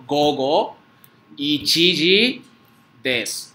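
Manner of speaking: gogo, ichiji, desu separados con pausas; despacio, cada palabra aparte para los alumnos; palabra por palabra. Shown as a demonstration. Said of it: "Porque ya viene P M primero". (pausado, palabra por palabra)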